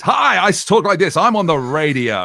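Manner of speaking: slow, put-on cheesy DJ announcing the station; mock the fake polished radio voice, drawn out. Host mocks old-style radio presenters, slow hammed-up DJ voice. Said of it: "we wanna change your voice".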